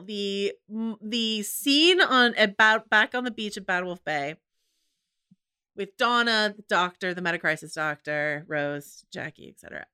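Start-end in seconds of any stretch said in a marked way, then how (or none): none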